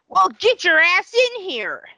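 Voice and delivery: high, loud, gleeful command; gleeful sadistic dentist. Retold or imitated. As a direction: bark him into the chair with cruel delight. Dentist ordering patient into chair.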